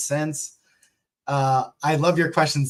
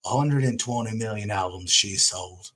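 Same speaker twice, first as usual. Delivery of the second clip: lower, put-on English accent; a friend's English accent, lower and clearly put on; 5 semitones lower, 4 dB quieter than usual. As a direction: do his English accent, a bit butchered. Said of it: "I'll butcher it, but I'll try it".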